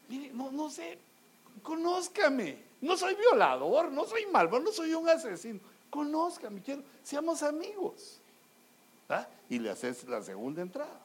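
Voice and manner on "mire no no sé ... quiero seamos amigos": higher, earnest plea of a nervous suitor; pleading suitor. Retold or imitated. Young man pleading with the sister to know him, high nervous plea. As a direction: beg her to trust you, voice lifted high.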